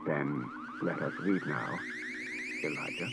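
low, soft, even robot voice; measured, unhurried machine politeness; a quiet humanoid robot speaking evenly; calm robot. A narrator's reading. Robot suggests leaving.

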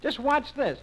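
put-on high-pitched little boy taking his turn; small boy showing off, high voice; Chicken Nose.

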